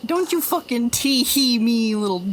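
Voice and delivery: loud, playful telling-off; mock scolding. Streamer telling off a tee-heeing viewer, mock scolding. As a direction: pretend to be outraged, loud and punchy.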